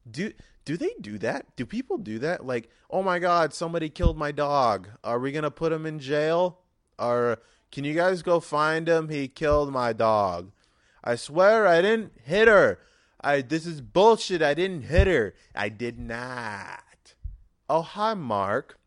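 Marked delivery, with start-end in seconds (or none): 2.9-15.3 loud, high overwrought plea of innocence; Tommy Wiseau. Acted. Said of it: "my Tommy Wiseau impersonation". 15.6-17.1 slow, dropped flat denial; Tommy Wiseau. Acted. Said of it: "my Tommy Wiseau impersonation". 17.7-18.8 slow, higher drawn-out greeting; Tommy Wiseau. Acted. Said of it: "my Tommy Wiseau impersonation".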